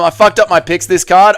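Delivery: ordinary talk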